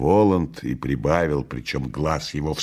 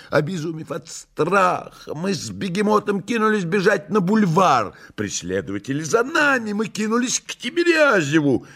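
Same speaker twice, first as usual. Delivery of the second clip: high, dramatic retelling voice; Koroviev, agitated; 14 semitones higher than usual. Acted. Koroviev recounting the chase, high agitated voice. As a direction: tell it high and in a flap.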